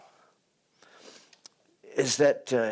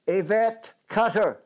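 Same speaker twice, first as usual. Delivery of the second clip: calling out a name; 7 semitones higher than usual. Acted. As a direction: announce the name like a herald. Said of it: "They shout out a name".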